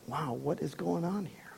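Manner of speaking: hushed, breathless wondering; awed whisper. Retold or imitated. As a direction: breathe it out in amazement. Repeats the disciples' bewildered words.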